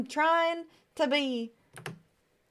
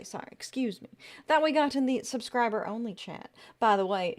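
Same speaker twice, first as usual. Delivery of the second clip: ordinary talk, nothing marked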